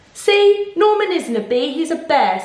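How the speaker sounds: proving she was right all along; triumphant. Acted. Amelia triumphantly exposes Norman.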